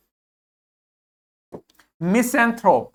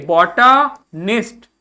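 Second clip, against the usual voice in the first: Botanist said slowly and clearly; slow teacher demo of botanist; slow model word; slower than usual. Shown as a demonstration. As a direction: draw it out for the class to copy. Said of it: "B O T A".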